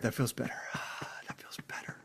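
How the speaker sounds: breathy whisper after a sigh; whispered relief. Real, not acted. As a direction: let it out in a whisper.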